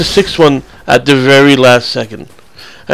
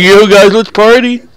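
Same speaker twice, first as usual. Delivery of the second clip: higher, louder hyped party voice; lightly voiced excited partier; party guy; 7 semitones higher, 4 dB louder, slower than usual. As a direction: invite everyone like a party animal. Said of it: "He's like".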